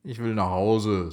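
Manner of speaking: ordinary delivery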